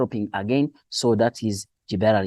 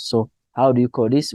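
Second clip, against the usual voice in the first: normal talking voice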